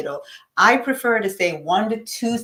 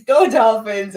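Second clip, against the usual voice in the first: team cheer; 3 semitones higher, 7 dB louder than usual. Real, not acted.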